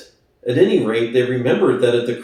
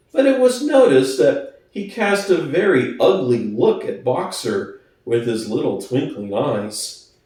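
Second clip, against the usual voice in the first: ordinary delivery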